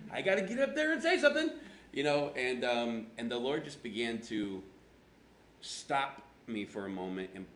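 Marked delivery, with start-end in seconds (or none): 0.1-1.5 very high, loud, fast squeal; laughing, hammed-up desperation; mock panic. Pastor praying about getting up to preach, comic panic. Acted pressure before Sunday's sermon.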